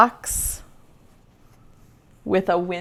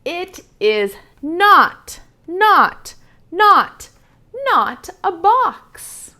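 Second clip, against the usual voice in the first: each not punched higher and louder, building; emphatic repeated not; 8 semitones higher, 6 dB louder, much slower than usual. A narrator's reading. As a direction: stomp each not like an insistent bunny. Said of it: "And the bunny says".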